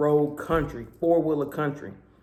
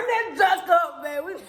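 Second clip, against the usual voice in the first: gleeful high call; 14 semitones higher, slower than usual. Retold or imitated. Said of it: "Our first night here".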